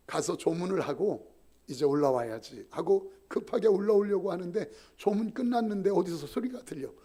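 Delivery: ordinary delivery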